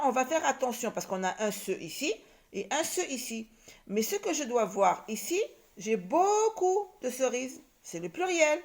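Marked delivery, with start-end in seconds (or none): 6.0-6.9 BEAUCOUP drawn long and higher; stress on BEAUCOUP. Shown as a demonstration. Teacher explains the plural, BEAUCOUP stretched for emphasis. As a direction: stretch BEAUCOUP to show how many.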